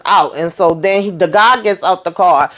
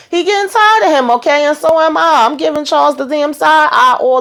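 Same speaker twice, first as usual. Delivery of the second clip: exasperated; 6 semitones higher than usual. Real, not acted. Host exasperated with Charles.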